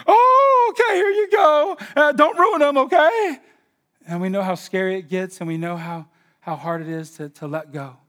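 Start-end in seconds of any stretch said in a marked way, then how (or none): 0.1-3.4 anxious parent shouting. Pretend. Parents sending kids to college.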